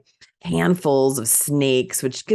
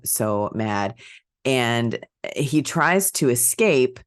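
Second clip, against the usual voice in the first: usual speaking voice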